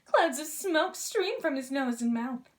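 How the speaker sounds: plain reading voice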